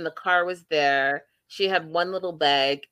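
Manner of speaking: normal talking voice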